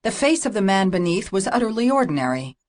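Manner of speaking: plain reading voice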